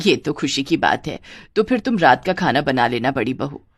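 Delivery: put-on low older-woman voice; mother-in-law. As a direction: speak as the lady of the house, pitched low. (सास की नीची आवाज़)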